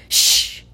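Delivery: hissed unvoiced shush; sharp shush. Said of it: "hissed Professor McGonagall".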